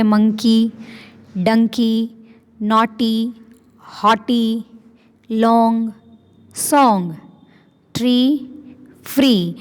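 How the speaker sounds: each word spaced, said clearly for repeating; reading words aloud for class. Shown as a demonstration. Teacher to class, modeling rhyming words to repeat, clear and spaced. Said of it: "साथ साथ repeat करना है".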